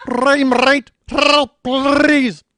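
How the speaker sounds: put-on loud, high sputtering growl with Australian accent; Roger Rabbit. Comedian playing a drowning cartoon rabbit, loud Australian sputter. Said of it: "Roger Rabbit drowning in Australian".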